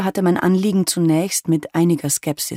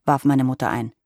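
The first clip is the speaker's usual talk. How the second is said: ordinary delivery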